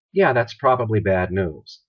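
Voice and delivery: ordinary delivery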